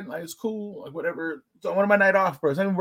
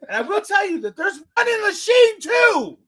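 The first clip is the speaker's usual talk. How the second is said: much higher, loud, laughing as he calls it out; excited laughing shout; 14 semitones higher, 6 dB louder than usual. Real, not acted. Naming the other location to the host, laughing loud. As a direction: shout the hint through laughter.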